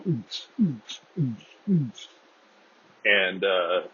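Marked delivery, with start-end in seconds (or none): 0.1-2.1 imitated house beat. Mimicking house music in the background, beatbox thump. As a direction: pulse it like club music. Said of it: "sexy house music bumping in the background".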